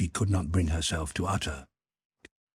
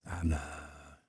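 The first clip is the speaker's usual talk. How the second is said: soft, much quieter murmur of her name; tender murmur; reproachful tender murmur; 10 dB quieter than usual. A narrator's reading. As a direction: gently reproach her, almost under the breath. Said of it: "he murmured with reproachful tenderness".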